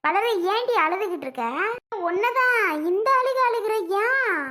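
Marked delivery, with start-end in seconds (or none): 2.1-4.4 high, slow, raised scolding. Acted. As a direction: demand why she is crying. Scolding over crying girl.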